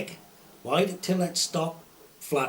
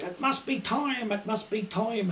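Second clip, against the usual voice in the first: mock shout; 7 semitones higher than usual. Old landlord calling time at the pub, high mock shout. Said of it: "old boy used to shout".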